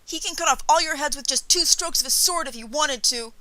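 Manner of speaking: put-on high, loud boy's voice; boy bragging at full volume; boastful boy. A narrator's reading. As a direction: crow it loudly like a proud kid.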